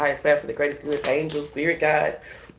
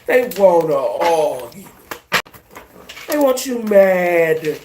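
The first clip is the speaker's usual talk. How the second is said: slow warning; 5 dB louder, much slower than usual. Real, not acted.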